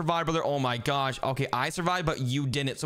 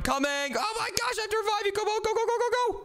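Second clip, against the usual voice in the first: high-pitched squealing urgency; frantic urging. Real, not acted. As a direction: rush to the rescue, frantic and high. Rushing to revive brother.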